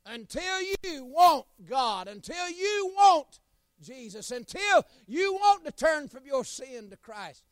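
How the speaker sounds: loud, high, slow preaching climax; a revival preacher's rising appeal; preaching at full force. Acted. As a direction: lift the congregation with each word.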